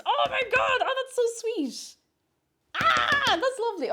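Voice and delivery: high, loud delighted gush; overjoyed at a lovely surprise; real happy squeal. Real, not acted. Touched by charity thanks.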